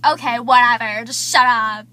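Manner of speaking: loud, fed-up dismissal; annoyed loud "just shut up"; loud brush-off. Retold or imitated. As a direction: shut him down hard. Desiree brushing Zach off.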